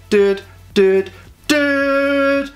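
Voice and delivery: high, excited surfer-dude voice, hammed up; mock panicked Rita. Rita watching the Zords, mock panicked dude voice. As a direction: play Rita freaking out over the countdown.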